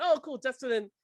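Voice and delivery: high, delighted fan reaction; retold excitement. Recalling seeing Brie there in the scene, excited. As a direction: relive being thrilled, voice lifted. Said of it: "I was just as excited".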